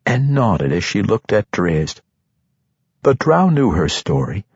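ordinary delivery, nothing marked

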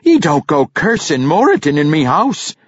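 high, loud dwarf mother's reprimand; outraged ma laying down house rules; scolding. A narrator's reading. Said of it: "Yuene scolded".

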